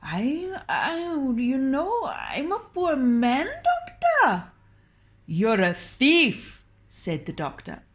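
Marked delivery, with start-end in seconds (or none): 0.0-4.5 high, slow whining plea; moaning. Acted. Ali pleads poverty to the doctor, high and whining. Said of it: "Ali began moaning". 5.3-6.6 slow, stern accusation. Acted. The doctor accuses Ali, slow and stern.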